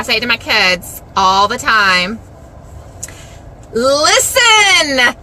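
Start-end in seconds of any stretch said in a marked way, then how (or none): 3.7-5.1 loud, high drawn-out call to listen; exclaimed command. Acted. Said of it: "Jesus says it with an exclamation mark".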